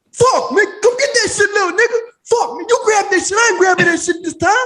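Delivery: hammed-up yelling at the lazy kid; ranting old man.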